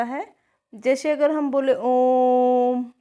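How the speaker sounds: ओम held long and slow; drawn-out ओम. Shown as a demonstration. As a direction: stretch ओम over three counts. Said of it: "तीन गुना समय लगता".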